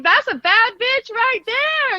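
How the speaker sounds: confident swagger. Retold or imitated. Acted self-praise quote.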